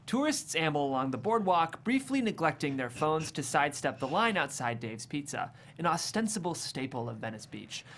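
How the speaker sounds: ordinary delivery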